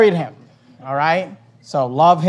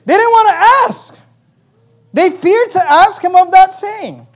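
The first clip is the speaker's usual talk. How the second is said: emphatic preaching; 11 semitones higher, 9 dB louder than usual. Real, not acted. Preacher driving the verse home to congregation, loudly emphatic. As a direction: hammer the point home at high pitch.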